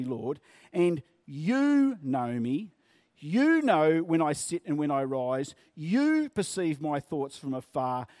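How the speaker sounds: "You" hit hard each time it comes, the rest of the line steady and slow; stress on every you. Shown as a demonstration. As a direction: lean on each "You" to show it is God alone. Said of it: "And it goes like this".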